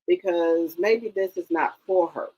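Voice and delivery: ordinary delivery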